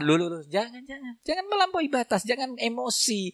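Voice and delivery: soft, high-pitched placating; hushed restraining. Acted. Ustaz calming an angry husband, soft high restraint.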